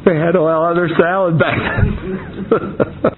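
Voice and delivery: amused aside to the listener; laughing aside. Real, not acted. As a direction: let the laugh spill into the line. Reader amused by the text.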